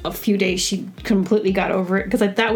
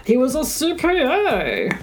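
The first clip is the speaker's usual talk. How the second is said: higher, hammed-up lament; mock wail; 5 semitones higher than usual. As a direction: tease with a fake heartbroken cry. Mocking her cried-out line.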